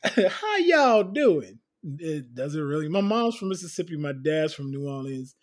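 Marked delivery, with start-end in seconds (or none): 0.0-1.6 slow Southern greeting through a laugh; y'all drawn out Southern; Southern drawl. Acted. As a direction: drawl it like Mississippi. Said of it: "I'm straight Southern".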